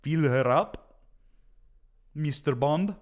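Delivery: mock Bond-villain Russian accent. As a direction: play Putin as a cheesy Bond villain, lightly accented. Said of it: "I gotta work on my Russian accent".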